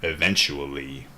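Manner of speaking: low drawn-out eventually; low ominous echo. Pretend. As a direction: drag it out low and menacing. Mock echo of the word.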